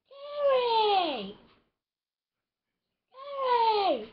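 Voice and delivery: high wail of the name; crying out a name. Acted. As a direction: cry out his name high and long. Crying out to Terry.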